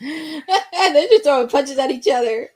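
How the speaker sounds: amused disbelief, chuckling while talking; laughing excitedly. Real, not acted. Watching wrestlers brawl, laughing in disbelief.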